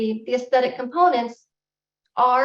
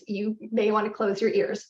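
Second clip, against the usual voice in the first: ordinary delivery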